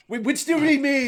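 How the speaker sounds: high confused villager. Retold or imitated. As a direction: sound baffled and squeaky.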